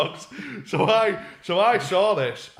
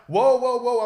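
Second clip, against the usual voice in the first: startled whoa; 8 semitones higher, 9 dB louder, slower than usual. Retold or imitated. Lads startled after being shoved.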